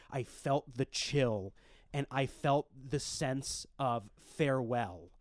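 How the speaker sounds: slow, hushed, fading storytelling; solemn dream narration. Acted. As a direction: tell it slowly, like a eulogy.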